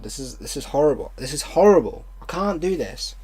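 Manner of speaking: normal talking voice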